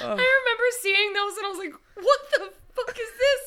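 high-pitched laughing words. Real, not acted. Laughing while retelling.

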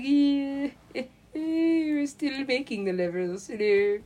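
high, drawn-out put-on alien voice; ascended alien. Aliens calling down while making levels, high and slow. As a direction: stretch the words out high, as a silly alien. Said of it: "they're just up there, like, ascended".

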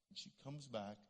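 ordinary talk, nothing marked

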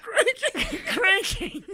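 giggling the word out high; saying it through helpless giggles; laughing. Real, not acted. Fit of laughter over crank.

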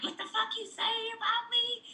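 high, hammed-up aggressive lady; mock angry yell. Retold street confrontation.

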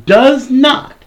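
does NOT, punched high and loud; insistent denial with NOT stressed. Real, not acted. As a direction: hit the word not hard. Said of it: "I repeat".

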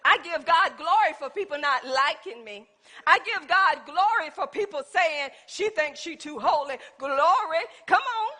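fervent praise, climbing in pitch; building, exultant pulpit delivery; impassioned preaching. Real, not acted. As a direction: preach it up to a shouted hallelujah. Preaches with rising fervour.

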